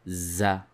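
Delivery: Za said with a clear buzzing z; teacher sounds out Za; z sound demo. Shown as a demonstration. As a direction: isolate the liaison as Za. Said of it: "pronounced as a Z".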